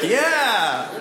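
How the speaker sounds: high, drawn-out yeah with laughter; excited confirmation. Retold or imitated. Visitor confirming to the man it was them, gleeful.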